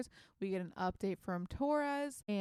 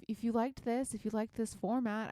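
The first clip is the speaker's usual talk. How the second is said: usual speaking voice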